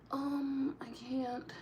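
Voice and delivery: unvoiced breathy whisper. Real, not acted. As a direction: hush it under your breath. Whispered lines.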